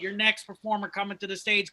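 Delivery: usual speaking voice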